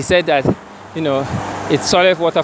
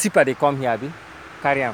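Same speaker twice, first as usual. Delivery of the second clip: casual Pidgin question; Nigerian Pidgin; 5 dB quieter, much faster than usual. Real, not acted. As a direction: ask it in Naija Pidgin. Switches to pidgin with worker.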